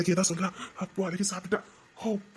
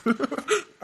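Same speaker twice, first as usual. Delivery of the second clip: short laugh; 5 semitones higher than usual. Acted. Laughs with relief.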